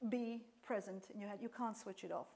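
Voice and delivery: ordinary talk, nothing marked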